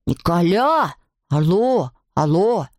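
drawn-out swooping hollers; shouting into phone. A narrator's reading. Alceste calls for his friend Nicolas, drawn-out phone hollering. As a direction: call down a bad line, stretching vowels. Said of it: "кричал Альцест".

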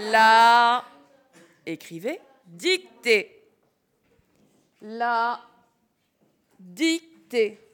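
slow, spaced-out dictation; dictating. Shown as a demonstration. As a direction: drop each word slowly for the class. Teacher dictating word by word.

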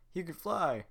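Peter Pan. Acted. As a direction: gasp it out in wonder. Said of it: "Peter Pan".

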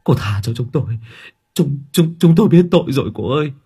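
panicked begging. A narrator's reading. Brothers kneel before the little girl, begging in panic. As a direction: beg for mercy in mounting terror. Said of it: "sự hốt hoảng đó".

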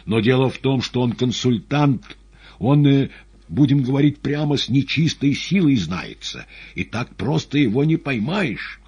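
high, loud and urgent; agitated madman poet, insistent. Acted.

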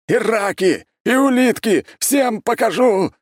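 high, loud bursts of threats; enraged roar. A narrator's reading. Mad ex-emperor rages on at everyone, roaring. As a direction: rave at the top of the voice. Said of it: "ревел Константин".

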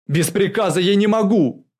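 loud, high-pitched refusal; flustered protest. A narrator's reading. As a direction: shout back in protest. Flustered officer shouts back refusal. (возражать криком)

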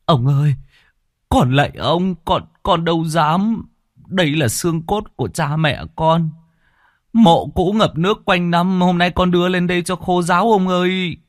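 high pleading voice; begging peasant. Acted. Beaten peasant begging the chief, high pleading.

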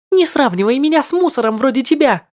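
high, loud offended retort; indignant girl. Acted. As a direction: snap back in a high indignant voice. (возмущённый высокий голос)

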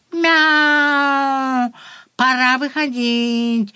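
high meow, then slow words; cat voice. Acted. The cat announces arrival.

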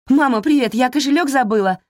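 bright, high, loud cheerful greeting; excited girl. Acted. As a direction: burst in, excited and carefree. Daughter bursts in cheerfully. (взволнованно, радостно)

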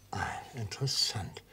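whispered aside. Acted. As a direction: breathe it out as an aside. Prosecutor murmurs over the answer. (geflüstert)

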